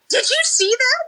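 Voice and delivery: high mock shout upward. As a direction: call it up to a window. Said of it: "she shouts up at me".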